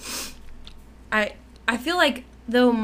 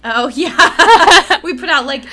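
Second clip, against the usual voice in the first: bursts out laughing, louder; 6 semitones higher, 14 dB louder, much slower than usual. Real, not acted. Co-host reminds her of the season, laughing loudly. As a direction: laugh it out as it dawns.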